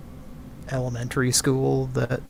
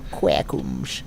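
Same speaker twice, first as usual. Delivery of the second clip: deep goofy voice; 10 semitones lower than usual. Pretend. Voiceover guy drops low.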